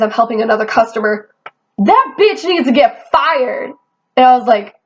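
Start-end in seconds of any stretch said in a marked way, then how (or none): 1.8-3.7 customer's insult. Retold or imitated. Customer insulting her, overheard.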